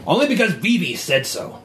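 a hint of a sulky goblin; goblin retort. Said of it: "From some goblin across the".

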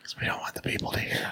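conspiratorial whisper. Real, not acted. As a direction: whisper like sharing a secret. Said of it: "Why are we whispering?".

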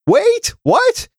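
high-pitched, incredulous double take; mock disbelief. Host reading a jab at a podcaster, mock disbelief. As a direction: squeak it out in exaggerated shock. Host reacts to tweet mocking him.

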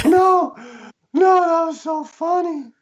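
very high, squealed mimicry of amusement; imitating tearful amusement. Michael reassures CJ through tears, imitated high squeal. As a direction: squeal it as if overcome by laughter. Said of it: "he was laughing".

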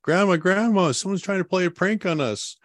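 put-on high, excited child calling out; child calling for grandma in alarm; young kid's voice. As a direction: play the startled little kid. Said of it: "I just hear this young kid's voice".

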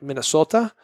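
attempted Minnesota accent. Host fumbling at how locals say it, mock Minnesota accent. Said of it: "they do got an accent".

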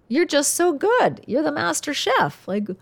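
high, bubbly mock praise for her son; joking gush of praise. Mother teasing her son about cooking, high playful gush. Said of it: "I was joking when I said that".

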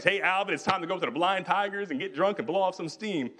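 high, cheery call from a drinking pal; rowdy buddy. Retold or imitated. Drinking buddy at the door urging Alvin out, in a high cheery call. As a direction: egg your friend on to a night of drinking.

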